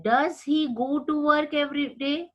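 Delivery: rising question tune on "work". Shown as a demonstration. Teacher reading a question aloud with a rise. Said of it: "It means it is a question".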